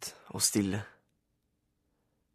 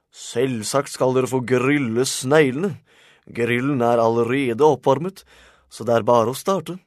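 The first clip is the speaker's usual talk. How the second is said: put-on old man's hearty announcement to his guests; obliging old man; 3 semitones higher, 9 dB louder than usual. A narrator's reading. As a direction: welcome them in loudly, stretching the words.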